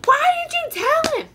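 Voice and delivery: high, strange mom voice scolding; mock exasperated mother; imagined mom scolding. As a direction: mom hissing blame at her daughter. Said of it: "I hear her saying".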